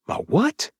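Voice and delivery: startled high question; shocked, pitch jumps; incredulous. Acted.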